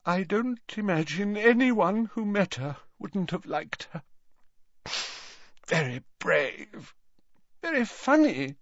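put-on high, tearful old professor. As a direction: speak through brimming tears. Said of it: "eyes brimming with tears".

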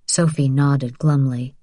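ordinary delivery

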